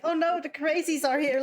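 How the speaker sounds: ordinary delivery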